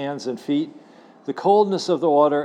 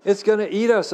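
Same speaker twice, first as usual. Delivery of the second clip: frightened cry; 6 semitones higher than usual. Acted. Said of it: "I cried".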